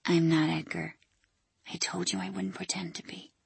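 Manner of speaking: soft, kind whisper. Acted. Dougie whispering to Ellen, kind but firm whisper. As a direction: whisper it gently into her ear. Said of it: "whispered in Ellen's ear".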